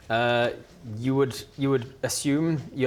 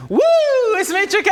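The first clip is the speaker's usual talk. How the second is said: flamboyant vampire parody; 10 dB louder, faster than usual. Retold or imitated. GM mocking the player's vampire voice, much higher and louder. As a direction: ham up the vampire entrance. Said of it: "You're like".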